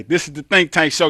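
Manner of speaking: upbeat radio host opener; show host intro. Acted. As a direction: announce the show with energy.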